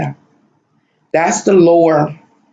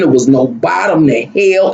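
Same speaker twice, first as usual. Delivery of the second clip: normal talking voice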